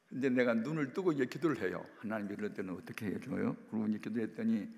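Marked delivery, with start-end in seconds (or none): none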